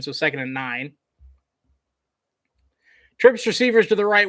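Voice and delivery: ordinary talk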